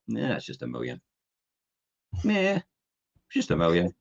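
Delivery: offhand shrug at huge news; unimpressed. Acted. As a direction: play it blasé, barely bothered. Winners shrugging off a million.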